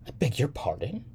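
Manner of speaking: high, rising polite question; lightly voiced startled woman; taken aback. A narrator's reading. Woman answering the young man in the elevator, taken aback.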